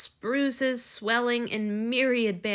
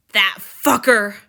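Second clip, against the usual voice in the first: much louder, slow furious snarl; 11 dB louder, slower than usual. Acted. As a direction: spit the insult with rage. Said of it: "Lord Julia snarled".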